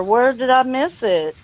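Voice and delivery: ordinary delivery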